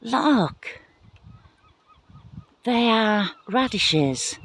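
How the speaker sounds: showing viewers her radishes, excited. Real, not acted. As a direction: call attention with real glee. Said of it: "I'm getting excited again".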